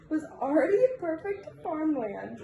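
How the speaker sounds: usual speaking voice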